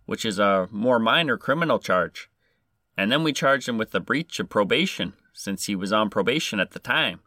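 lilting put-on Canadian accent. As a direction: play the constable with a Canadian accent. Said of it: "trying to do a Canadian accent".

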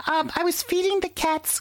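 high whiny wife voice. Retold or imitated. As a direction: squeak it like a sick wife. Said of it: "She goes".

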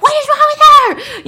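very high, loud mock yell; angry yell. Bus driver yelling about scratching Lizzie, angry mock yell. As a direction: imitate an outraged driver, pitch shooting up.

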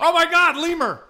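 high, loud startled cry; mock shocked shriek. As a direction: shriek it out in fake alarm. Sudden lemur joke outburst.

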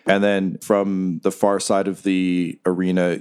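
normal talking voice

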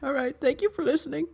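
mock crying. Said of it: "Could you do it without crying".

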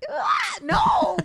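high-pitched wail of protest; mock horrified shriek. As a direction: cry out in comic horror. Teased about graduating in ninety-seven.

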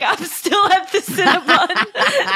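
mock the scammer while laughing; laughing impression. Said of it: "he's like".

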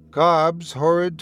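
plain reading voice

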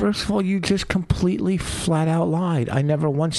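high-pitched outrage; indignant. Real, not acted. Indignant denial of a lie.

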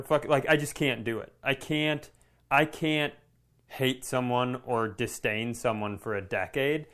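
ordinary delivery

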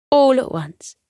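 plain reading voice

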